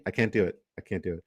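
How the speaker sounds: ordinary talk, nothing marked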